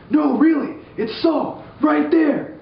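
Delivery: very high, urgent insisting; high panicked voice. Retold or imitated. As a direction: squeak it out in alarm. Mighty men whispering.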